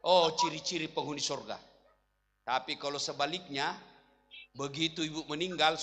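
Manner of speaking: ordinary talk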